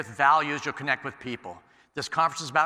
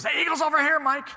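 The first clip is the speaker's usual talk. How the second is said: high, loud alarmed cry to Mike; petrified; 12 semitones higher, 5 dB louder than usual. Retold or imitated. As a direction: call out in a panic as the eagle nears. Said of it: "My boss is petrified".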